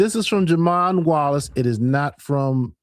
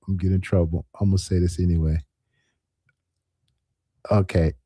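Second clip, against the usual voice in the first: ordinary talk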